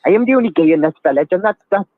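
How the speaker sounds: quick sing-song Welsh impression; Welsh accent. Caller quotes a Little Britain character, putting on a Welsh accent. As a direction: put on your best comic Welsh accent. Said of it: "That's the best Welsh accent I can do".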